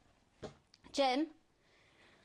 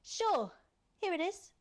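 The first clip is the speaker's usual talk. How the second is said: much higher, eager mock yes-man voice; mock wimpy Alex; 10 semitones higher than usual. Villain mimics Alex giving in, high simpering voice. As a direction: squeak it out like a doormat. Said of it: "you're acting like a bit of a wimp".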